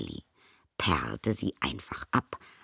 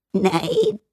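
very high, louder giggled no; giggling; 8 dB louder than usual. A narrator's reading. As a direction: giggle the word out. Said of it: "kicherte das Tingeli".

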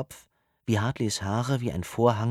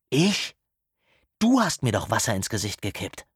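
indignant disbelief; 3 dB louder than usual. A narrator's reading. Easton protests after being doused.

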